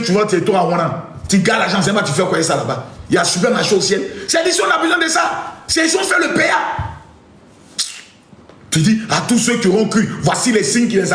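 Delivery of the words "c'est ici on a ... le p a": high shout, insistent; demanding shout. Acted. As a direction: yell the demand up to heaven. Demanding money from God. (crier fort, exigeant)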